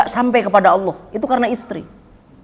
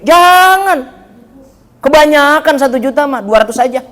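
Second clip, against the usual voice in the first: much higher, louder protesting wife voice; mock nagging wife; 8 semitones higher, 4 dB louder than usual. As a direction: play the wife cutting the money down, shrill-free but high and loud. Wife objects to husband's budget. (istri yang protes dengan suara tinggi dan keras)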